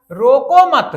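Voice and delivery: loud "रोको मत" as one group, then stop; pause after मत. Shown as a demonstration. Teacher shows comma moved, loud joined "रोको मत". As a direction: join "मत" onto "रोको", punched. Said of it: "स्थान बदल दिए".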